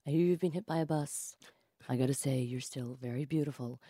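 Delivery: soft, low honeyed imitation; The Rock. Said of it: "with his honeyed tones".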